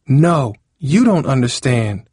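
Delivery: slow, high, emphatic retort; yelling back. A narrator's reading. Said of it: "Cindy yelled".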